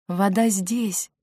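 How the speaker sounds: slow, gentle reassurance; tender and unhurried; soothing. Acted. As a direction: reassure someone ill, slowly. Girl soothing the sick boy.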